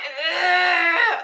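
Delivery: loud, high effortful grunt; straining grunt. Retold or imitated. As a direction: imitate pushing hard with a grunt. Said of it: "what I mean by the grunt".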